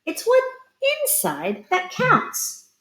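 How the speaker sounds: high slow chorus. A narrator's reading. Pumpkins agreeing together.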